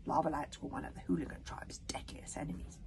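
usual speaking voice

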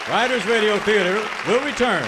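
normal talking voice

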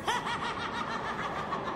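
high, airy cackling laugh; a mischievous witchy giggle; light, high witch's cackle; wicked witch cackle. Acted.

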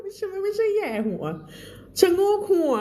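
high, laughing self-correction. Real, not acted. Teacher laughing at her own wrong Thai word. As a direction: giggle while fixing the word.

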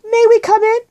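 put-on anxious girl calling; shouted question. A narrator's reading. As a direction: call high to be let in. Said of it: "she shouted".

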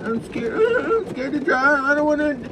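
high whiny mock voice with a laugh; mocking a scared driver. Trucker mocking drivers scared of hail, whiny high mock. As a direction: sneer it in a baby voice. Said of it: "for being a little scared".

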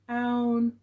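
usual speaking voice

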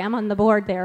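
normal talking voice

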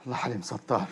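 low, soft sheepish murmur; calm her down in a low voice; hushed placating. Acted. Husband quietly placating his upset wife.

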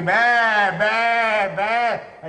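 high, loud mocking goat bleat; high, loud jeering bleats; jeering kids bleating. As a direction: bleat it like boys mocking a cuckold. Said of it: "ragazzini dietro in baldoria a gridarmi".

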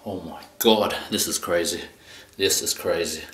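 usual speaking voice

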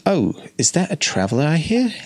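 a hint of a sweet grandma; lightly voiced old granny. Granny Chew greeting a traveller in a light old-lady voice.

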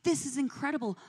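mild, retold awe without real sobbing; retold tearful awe. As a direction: recall a tearful prayer, pitch lifted. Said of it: "sitting at my little table crying".

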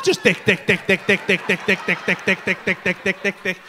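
low, mechanical, evenly repeated beat; printing machine. Acted. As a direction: rattle the word out like a production line. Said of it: "just started printing his dick off".

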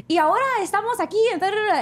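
high, rapid Spanish-language reporter patter; girl reporters. Retold or imitated. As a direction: imitate eager reporters, rattling off Spanish up high. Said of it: "most of the girls there are, like, being like".

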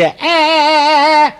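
high, drawn-out bawling wail; hammed-up toddler sobbing; mock child's crying. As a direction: imitate a small kid bawling. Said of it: "નાનું છોકરું સીડીએથી રડતું રડતું".

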